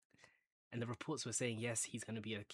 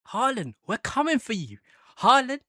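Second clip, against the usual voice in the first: much louder, higher rallying yell at the rival; taunting shout; 12 semitones higher, 16 dB louder than usual. Pretend. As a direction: call out the rival like a terrace chant.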